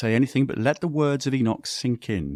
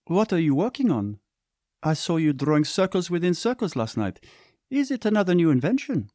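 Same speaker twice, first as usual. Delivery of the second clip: put-on higher, curious young woman; a bit of wonder; 9 semitones higher than usual. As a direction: sister curious about his invention, a touch of wonder. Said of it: "with a bit of wonder in her voice".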